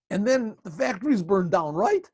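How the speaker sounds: rising high with joy at the end; delighted husband topping the bad news; gleeful. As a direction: crow it out happily.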